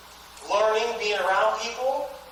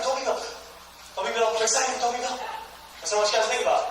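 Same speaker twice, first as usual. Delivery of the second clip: normal talking voice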